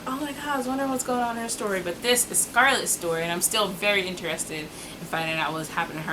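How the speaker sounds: usual speaking voice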